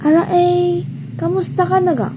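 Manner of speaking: high, sing-out Batangas greeting; Batangas accent. Shown as a demonstration. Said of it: "Example na dito is yung Batanggenyo".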